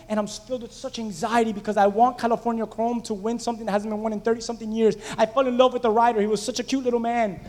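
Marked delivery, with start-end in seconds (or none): none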